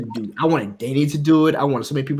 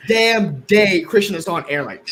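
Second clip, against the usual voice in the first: ordinary talk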